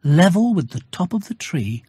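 ordinary delivery

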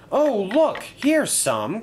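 high-pitched, excited exclamation; happy cry. A narrator's reading. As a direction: cry out happily, pitch leaping up. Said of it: "cried Thomas happily".

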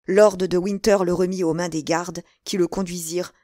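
ordinary delivery, nothing marked

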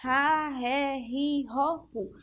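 Ra, ré, ri, ro, ru spoken one by one, each syllable set apart; sounding out syllables. Shown as a demonstration. Teacher sounding out the R family for pupils, separate syllables. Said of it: "vocês precisam ver".

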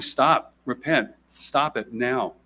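repent drawn out slow and heavy; urgent call to repent. Real, not acted. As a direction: hammer home stop and repent. Preacher calls racists to repent.

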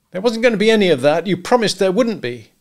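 high, fast, loud protest; angry accusation. A narrator's reading. Husband to wife over broken promise, indignant. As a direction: accuse her of breaking her word.

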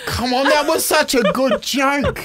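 half-laughing indignation. Real, not acted. Defends his joke, laughing.

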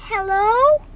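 little bunny picking up the phone; chirpy phone hello. Retold or imitated. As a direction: answer brightly. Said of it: "she's like".

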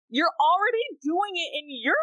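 venting at companies over privacy rules, exasperated and loud. Real, not acted. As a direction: vent your frustration. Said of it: "It's so infuriating".

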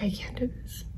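retold plea, very soft, almost whispered; quiet confession. Mother confessing to her husband that she can't cope, hushed. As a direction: drop to a near-whisper, as if admitting it.

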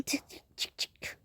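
voiceless clicking mouth noises; card machine clicks. Pretend. Pretending to swipe a card at reception, voiceless clicks. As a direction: click it out like a card reader beeping.

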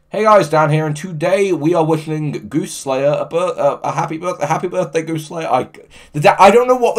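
peppy gaming YouTuber wishing happy birthday; DanTDM voice. Pretend. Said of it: "can you do the Dan T D M voice".

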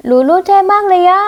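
high, loud, gushing praise; excited cheer. Acted. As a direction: squeal with delight at her friend. Friend cheering the kick.

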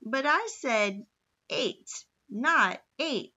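slow, stressed eight, not eight; protesting. A narrator's reading. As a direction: drag out each eight in protest. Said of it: "he protested".

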